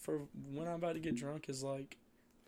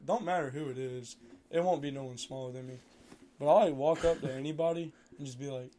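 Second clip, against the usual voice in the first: usual speaking voice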